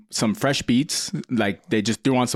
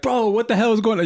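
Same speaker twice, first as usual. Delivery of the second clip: shocked friends; 10 semitones higher than usual. Retold or imitated. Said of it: "everybody's like".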